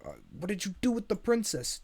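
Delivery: high, loud frightened accusation; alarmed demand. Acted. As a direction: demand it in a panic. Donkey confronts the supposed monster.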